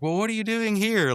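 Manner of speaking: very high-pitched imitated woman's voice; Margaret. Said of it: "then Margaret's like".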